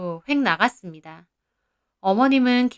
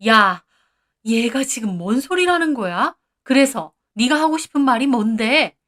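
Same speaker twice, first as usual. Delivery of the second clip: angry yell; 2 semitones higher, 3 dB louder than usual. Acted. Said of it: "소리를 버럭 지르시더라고요".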